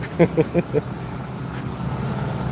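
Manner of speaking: quiet, rapid panting; catching breath. Real, not acted. Walker pauses on the steep hill, panting. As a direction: puff hard after a steep climb. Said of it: "Let me catch my breath".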